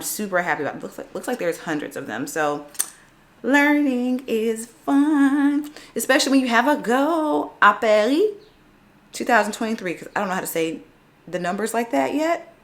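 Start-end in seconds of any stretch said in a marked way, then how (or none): 3.4-9.9 cheerful. Real, not acted. Cheerful goal statement.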